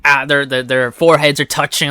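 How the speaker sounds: usual speaking voice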